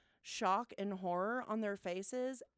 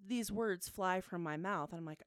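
ordinary talk

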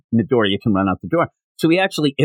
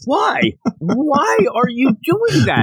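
very high, drawn-out mock disbelief; exaggerated bafflement; 13 semitones higher, slower than usual. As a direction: squeal the question in comic dismay. Baffled reaction to the yelled word.

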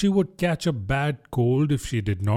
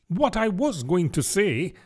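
higher-pitched, lightly wounded dignity; resume as if slightly affronted; offended; 7 semitones higher than usual. A narrator's reading. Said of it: "in an offended tone".

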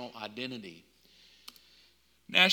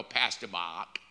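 put-on Boston accent; 8 semitones lower than usual. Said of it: "she's got the Paktika Pastor Mark".

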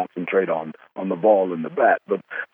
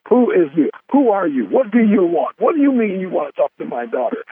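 higher, louder, suspicious parent demanding who's calling; startled parent on phone; 10 semitones higher, 4 dB louder than usual. Retold or imitated. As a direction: play the alarmed father grilling a stranger caller. Parents answering the phone, retold.

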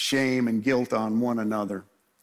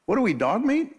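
mock-outraged brother; indignant outburst; 7 semitones higher than usual. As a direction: throw it back at the father, wounded. Brothers indignant at father's favoritism.

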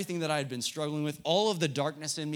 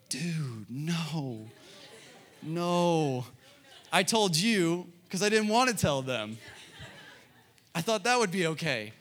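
mock protest; 4 semitones higher, slower than usual. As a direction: push back harder with each no. Protesting to leader about telling parents.

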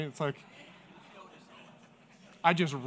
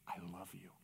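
low, hushed, sincere declaration; tender near-whisper; 11 semitones lower, 20 dB quieter, faster than usual. Retold or imitated. Pastor to the man in the purest way, tender. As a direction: lower it almost to a whisper, tenderly.

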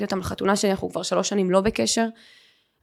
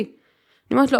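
ordinary delivery

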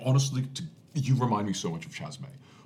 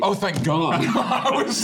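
laughing relief; 11 semitones higher, 8 dB louder, much slower than usual. Real, not acted. Relief after big damage.

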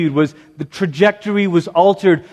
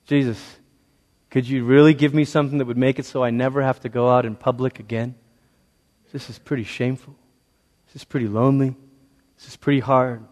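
low, soft, hurting plea; subdued confession, sinking softer toward the end; pained; 9 semitones lower, 7 dB quieter, faster than usual. Acted. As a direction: voice her quiet shame and loneliness. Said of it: "You can almost hear the pain in her voice".

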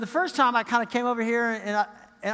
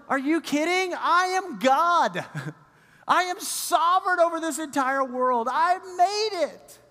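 slow, higher, emphatic; God answers the excuses, slow higher mock indignation; God's mock disbelief; 7 semitones higher than usual. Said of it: "God's going".

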